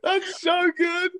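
real high squeal through laughter; slow, squeaky, crying with laughter; helpless laughter. Real, not acted. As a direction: wheeze it out while cracking up. Cracking up at the prank impression.

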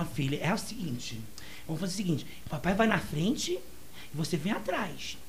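papa rabbit explaining things to his little one, low and soft. Acted. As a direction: play the calm papa rabbit, voice dropped low. Father rabbit voiced low.

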